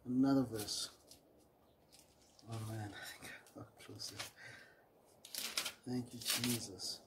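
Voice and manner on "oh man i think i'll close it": quick, breathy whisper to himself; whispered aside. Real, not acted. Preacher to himself, losing his place: hushed quick whisper. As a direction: whisper it under your breath, fast.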